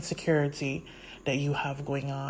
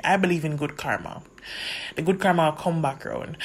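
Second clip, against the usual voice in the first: ordinary talk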